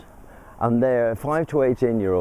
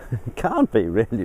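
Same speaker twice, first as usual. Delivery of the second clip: ordinary delivery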